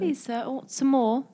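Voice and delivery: hammed-up British accent; mock British accent. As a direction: play at a pleading British waif's accent. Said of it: "Oh, it's an accent".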